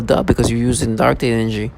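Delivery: ordinary talk, nothing marked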